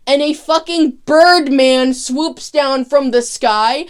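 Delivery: ordinary talk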